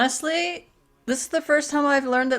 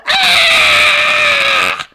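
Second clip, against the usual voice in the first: high, loud, shrill-pitched mock scream; octopus scream; 8 dB louder than usual. Imitating an octopus screaming as it's killed, loud and high. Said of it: "they would go".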